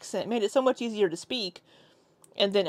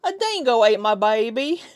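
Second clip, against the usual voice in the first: louder, hammed-up Aussie accent; mock Australian accent; 2 semitones higher, 6 dB louder than usual. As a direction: quote it in an exaggerated Australian accent.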